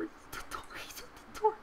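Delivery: urgent, voiceless warning; frightened whisper. Real, not acted. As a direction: whisper a warning while hiding. Monster at the door, hiding.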